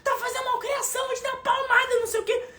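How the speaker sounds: very high shrill mock tantrum of an angry mother; mocking screaming mother. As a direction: ham up a screaming mother scolding a child. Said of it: "combater fogo com fogo". (imitando mãe gritando com a criança, bem aguda)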